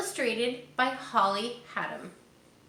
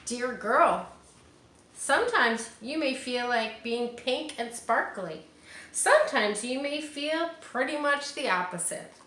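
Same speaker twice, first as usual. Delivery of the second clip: put-on reading to children; 4 semitones higher than usual. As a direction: read it like a picture book to little ones. Reading picture book aloud.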